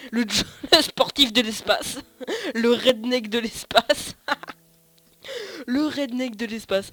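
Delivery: loud, amused and laughing. Real, not acted. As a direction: crack up at your own silly idea. Amused by own invented title.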